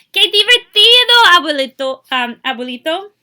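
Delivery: high, loud joyful cry; thrilled child calling to abuelito; excited. Acted. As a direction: shout your delight at the kite. Child's excited exclamation in Spanish.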